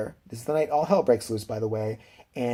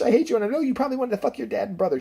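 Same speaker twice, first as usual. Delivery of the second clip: higher-pitched mock accusation; imitated angry mother; 9 semitones higher, faster than usual. Mom struggling with her daughter, high mock-angry rant. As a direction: hurl it quickly as a ranting mom.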